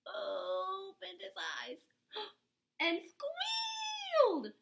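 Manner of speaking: high, slow, squealing fright; hammed-up scared squeal; mock squeal. A narrator's reading. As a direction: squeal like a startled pig. Narrator voices pig's fright.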